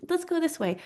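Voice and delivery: high, light and breezy; breezy carefree call. Retold or imitated. Leading friends somewhere unknown, a high breezy call. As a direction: toss it off like you're leading an adventure.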